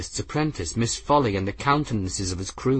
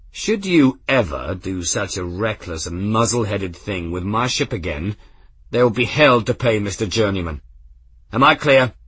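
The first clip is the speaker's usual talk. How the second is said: stern reprimand. Acted. Captain scolding reckless engineer.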